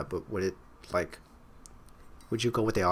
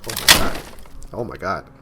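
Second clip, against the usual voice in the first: shocked gasp; 7 dB louder than usual. Real, not acted. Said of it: "The hell?".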